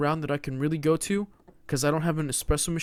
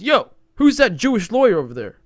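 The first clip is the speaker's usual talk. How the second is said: imitating a bar guy calling out; 8 semitones higher, 10 dB louder than usual. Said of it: "and he goes".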